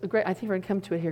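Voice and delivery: ordinary talk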